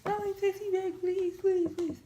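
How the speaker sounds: high, pleading whine of please; hammed-up pleading boyfriend; mock begging. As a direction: mimic him begging her to text back. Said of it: "you wouldn't take me back".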